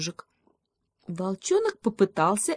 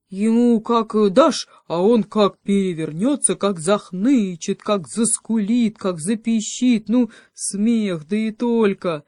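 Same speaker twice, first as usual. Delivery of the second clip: loud, higher, drawn-out verbs; gleeful retelling; 4 semitones higher, 6 dB louder than usual. Acted. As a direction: relish the mischief, building item by item. Волчонок азартно описывает свои проделки. (азартно и громко, смакуя)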